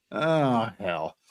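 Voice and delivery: ordinary talk, nothing marked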